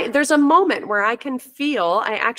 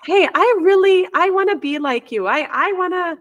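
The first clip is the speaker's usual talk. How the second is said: put-on child voice; 6 semitones higher than usual. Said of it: "one of the kids came up and said".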